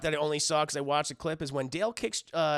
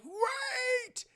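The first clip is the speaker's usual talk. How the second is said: put-on high, very slow old rat cry; hammed-up doddery wail; ancient cabin boy; 11 semitones higher, much slower than usual. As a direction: call feebly after the captain. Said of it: "cabin boy rat's like".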